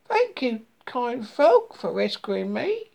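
put-on high-pitched baby mole giving thanks; high little creature, gleeful; gleeful young mole. A narrator's reading. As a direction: thank your rescuer in a high, happy voice. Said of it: "jumped with glee".

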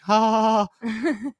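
high fake laugh; mock laugh. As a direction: cackle up high, playfully. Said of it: "sitting there all like".

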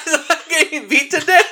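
ordinary delivery

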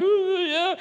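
very high, loud whoop; mock shriek of excitement; excited squeal. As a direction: let out a high-pitched squeal. Acting out sisters' screaming excitement.

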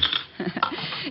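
soft breathy chuckle. Acted. Mother laughs at daughter-in-law.

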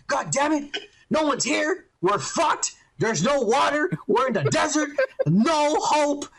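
high-pitched mock panic rant; freaking out, mad. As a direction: act out a frantic, angry meltdown. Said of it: "he starts freaking out and getting mad".

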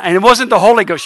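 usual speaking voice

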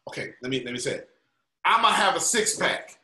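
normal talking voice

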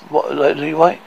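plain reading voice

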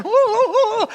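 high, loud mock cheering woo; imitating Steve's woo. Preacher imitating Steve whooping during praise music, loud and high. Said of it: "You got Steve back here going".

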